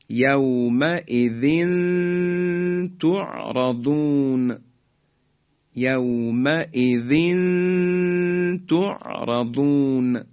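"یومئذ تعرضون" slowly, the tanween held as a hum before ta; ikhfa. Shown as a demonstration. Teacher recites next example for students, slow ikhfa. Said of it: "تنوین رسید به ته دو نقطهٔ".

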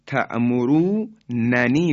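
تأمروننی said with two separate نون sounds; original ta'murunani. Shown as a demonstration. Teacher showing the original unmerged form. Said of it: "در اصل".

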